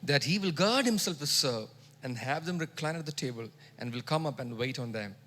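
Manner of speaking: quiet, reverent reading; softening quote. Retold or imitated. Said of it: "Truly I say to you".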